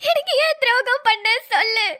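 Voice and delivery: broken laugh-cry of betrayal; sobbing. Acted. Bride sobbing at her friend for betraying her. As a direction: sob out the accusation.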